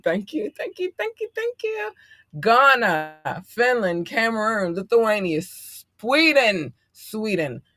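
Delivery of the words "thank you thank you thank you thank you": climbing into a tiny high voice; gushing thanks. Real, not acted. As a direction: rattle off thanks, rising to a squeak. Repeated gushing thanks to listeners.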